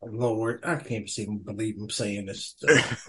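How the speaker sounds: low, very soft aside; exasperated muttering. Real, not acted. Guest reacting to the host's mascot question, muttered in disbelief.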